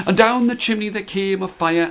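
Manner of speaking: plain reading voice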